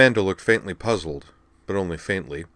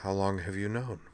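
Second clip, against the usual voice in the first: soft, plain question; understated, hushed asking; simply; 8 dB quieter than usual. A narrator's reading. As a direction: ask it quietly, without emphasis. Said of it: "Then she asked simply".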